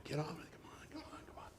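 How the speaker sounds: whispered urging, breath held; tense whisper. Retold or imitated. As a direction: hiss it with impatience. Holding breath waiting for name.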